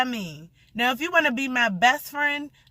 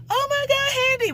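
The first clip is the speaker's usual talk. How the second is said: much higher, delighted cry; excited squeal; 14 semitones higher than usual. Retold or imitated. Running to hug her friend Handy, a high delighted squeal. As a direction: gush at him as you run over.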